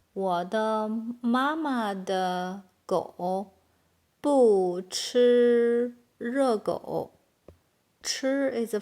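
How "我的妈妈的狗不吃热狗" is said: very slow, drawn-out syllables; word by word. Shown as a demonstration. Teacher building a long Chinese sentence for learners, slowly, word by word. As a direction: stretch each word out for learners. Slow model, then natural take.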